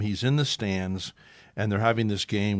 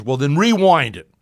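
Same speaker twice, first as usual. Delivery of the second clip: louder firm order; gruff command; 4 semitones higher, 11 dB louder than usual. Retold or imitated. Lasorda ordering the reporter to rewind, louder. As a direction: tell him what to do.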